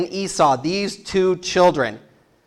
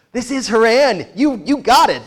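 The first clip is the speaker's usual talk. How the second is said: higher, upbeat reassurance; cheerful herder; 6 semitones higher than usual. Retold or imitated. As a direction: tell him brightly he's arrived. Herders cheerfully answer Jacob.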